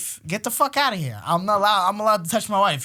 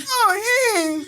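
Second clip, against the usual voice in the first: fake casual; 4 dB louder than usual. Dad caught, pretending innocence.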